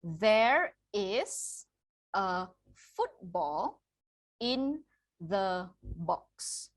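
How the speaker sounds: slow reading demo. Shown as a demonstration. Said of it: "One more time".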